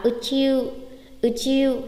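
"ư chiu" with the final u drawn out; long vowel. Shown as a demonstration. Teacher modelling long vowels, "ư chiu" ending long. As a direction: hold the end of "ư chiu". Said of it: "Kéo dài ra thôi".

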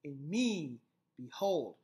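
in ME, beHOLD, stretched on me and hold; light in and be, weighty me and hold; stress on beats. Shown as a demonstration. Teacher marking the last iambs, stress on me and hold.

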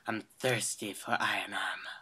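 unvoiced fast whisper. Real, not acted. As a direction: whisper like a sneaky predator. Sneaking up on kid.

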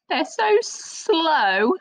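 "so slow" stretched long and high; drawn out slowly. Shown as a demonstration. Teacher to children about sloths, slow and stretched. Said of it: "I like sloths".

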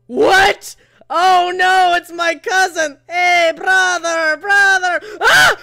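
loud panicked cries at a jump scare; startled, shouting high; scared shouting. Real, not acted. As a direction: yell in real fright. Jump scare, screaming at cousin.

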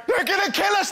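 high, loud panicky cry; panicked pensioners. Retold or imitated. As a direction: shriek like a scared pensioner. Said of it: "pensioners going".

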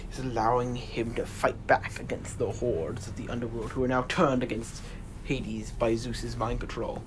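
usual speaking voice

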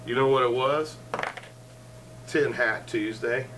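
ordinary talk

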